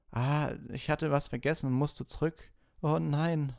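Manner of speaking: put-on high, hurried girlish explaining; flustered Hermine. Hermine explaining to Ron, then dismayed. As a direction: brush it off, then notice the torn bag.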